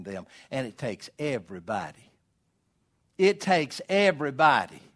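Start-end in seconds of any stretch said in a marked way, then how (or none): 3.2-4.8 louder, firm declaration; emphatic refrain. Real, not acted. Preacher setting up a call and response, emphatic. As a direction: state it strongly.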